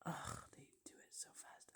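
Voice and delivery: soft frustrated whisper. Real, not acted. A viewer annoyed at fast scenes, whispering. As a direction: whisper the complaint under your breath. Whispering while watching clips.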